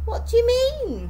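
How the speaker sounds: high, loud startled question; taken aback, pitch leaping up. A narrator's reading. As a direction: ask it caught off guard. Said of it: "Esmeralda looked up startled".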